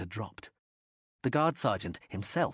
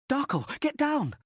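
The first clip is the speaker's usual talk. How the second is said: high, louder urgent scream; 5 dB louder than usual. A narrator's reading. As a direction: yell for her to get down. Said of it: "Liv Waiter screamed".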